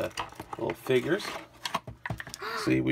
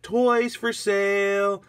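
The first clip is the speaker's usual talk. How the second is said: slow, high, stretched-out announcement; drawn-out sign reading; 8 semitones higher, 4 dB louder, much slower than usual. Acted. Reading Agnes's newly painted sign, slow and high. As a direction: call it out slowly like a vendor.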